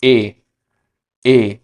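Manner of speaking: ए as a clean Spanish "e"; Spanish letter name. Shown as a demonstration. Teacher to students, careful Spanish vowel. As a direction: repeat ए slowly for learners. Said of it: "तो ई की sound हुई".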